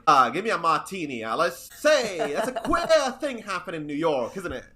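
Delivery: put-on hammy old-timey mid-Atlantic accent. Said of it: "this is my really bad mid-Atlantic accent".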